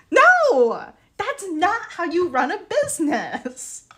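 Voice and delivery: high-pitched protest, loud burst on "No"; exasperated outburst. Real, not acted. Seller objecting to how the store is run, exasperated. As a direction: cry out in disbelief, pitch way up. Outburst about unpriced goods.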